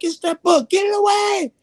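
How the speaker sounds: very high, frantic cry; panicked shriek. Retold or imitated. The book standoff with the gang leader, panicked shriek. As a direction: shriek to get it away. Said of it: "I'm like".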